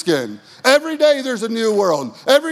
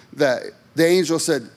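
ordinary talk, nothing marked

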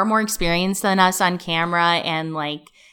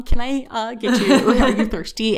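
higher, laughing eager-helper voice; eager assistant voice; 5 semitones higher than usual. Retold or imitated. An assistant nervously offering the guest star a drink, higher and laughing.

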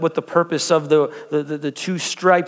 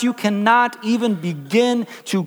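higher, rising preaching voice; 4 semitones higher than usual. Acted. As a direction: build the message with fervor. Pastor preaching from translation.

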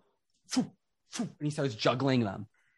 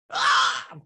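mock scream; 7 dB louder than usual. Guillotine scream sound effect.

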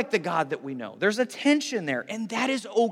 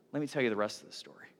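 quick, lower, softer teaser line; hushed teasing storyteller; 9 semitones lower, 9 dB quieter, faster than usual. Retold or imitated. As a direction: lean in and drop the voice to hook them. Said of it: "And he says".

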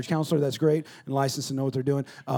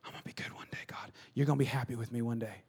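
whispered plea; 4 semitones lower, 10 dB quieter, faster than usual. Retold or imitated. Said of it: "You're like".

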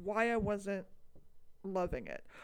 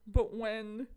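ordinary delivery

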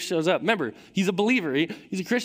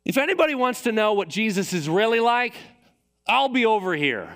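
raised, higher-pitched announcement; sudden outburst; 5 semitones higher, 3 dB louder than usual. Retold or imitated. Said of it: "goes like this".

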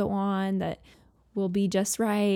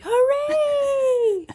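very high, louder mock hooray; imitated cheer; 5 dB louder than usual. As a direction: cheer like a happy child. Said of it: "I was expecting him to say".

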